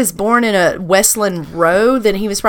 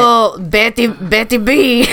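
put-on botched foreign accent, maybe French; really bad accent; 2 semitones higher than usual. Co-host has a go, bad muddled foreign accent. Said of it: "that's a really bad accent".

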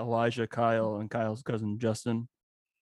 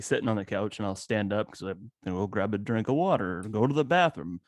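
usual speaking voice

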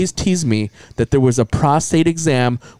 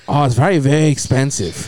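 Chinese doctor. Retold or imitated. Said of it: "my Chinese".

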